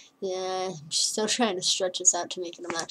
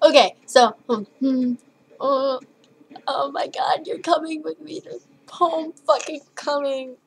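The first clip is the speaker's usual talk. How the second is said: giddy excitement; 6 semitones higher, 4 dB louder than usual. Real, not acted. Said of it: "I need to calm down".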